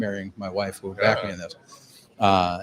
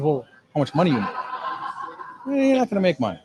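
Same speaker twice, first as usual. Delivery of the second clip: imitating Korean wife; 6 semitones higher than usual. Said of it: "leaves all the, the vowels out".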